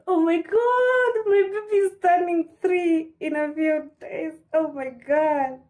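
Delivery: thrilled. Real, not acted. Telling viewers her son turns three, squealing with excitement. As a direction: gush like you can't believe it. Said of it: "I'm super excited".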